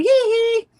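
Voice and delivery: high, loud "hee hee"; imitated villain giggle. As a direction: squeal it like a capering madman. Villain jumping around, going hee hee.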